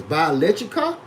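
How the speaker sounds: usual speaking voice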